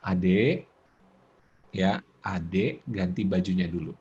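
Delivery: low, slow, patient parent voice; calm parent. Shown as a demonstration. Parent asking child to change clothes, calm and patient. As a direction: coax the child softly and patiently.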